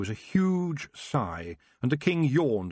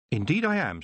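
put-on higher, bold young witch's challenge; proud, pitched-up challenge; defiant young witch; 7 semitones higher, 3 dB louder than usual. A narrator's reading. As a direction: face them down as a young witch. Young witch answers the king.

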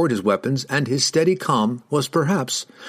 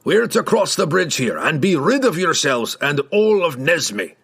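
higher, emphatic dwarf contempt; dismissive dwarf; 6 semitones higher than usual. A narrator's reading. Dwarf tells riders he is leaving, contemptuous. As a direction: wash your hands of them, gruffly.

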